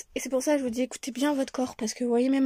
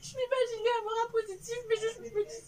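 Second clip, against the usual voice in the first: high, tearful burst of joy; happy crying; 12 semitones higher, 4 dB quieter than usual. Real, not acted. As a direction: cry out with overwhelming joy. J'en tremble.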